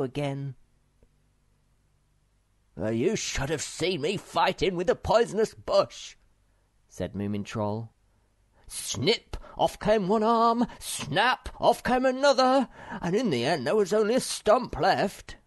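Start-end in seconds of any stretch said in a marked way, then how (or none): 2.8-6.2 excited boast. Acted. Boasting child retelling a fight. 8.7-15.4 put-on high, loud boasting child voice; excited retelling. Acting out the fight.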